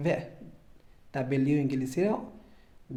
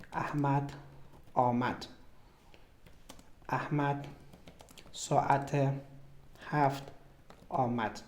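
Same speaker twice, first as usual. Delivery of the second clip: slow repeated example; 7 dB quieter, slower than usual. Shown as a demonstration.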